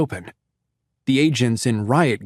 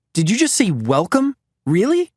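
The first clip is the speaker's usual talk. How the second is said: incredulous; 8 semitones higher than usual. Acted. Sean incredulous at the word.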